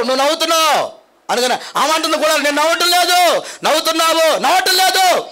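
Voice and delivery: animated retold argument; playful mock quarrel. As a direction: act out both sides of the squabble. Acted back-and-forth quarrel.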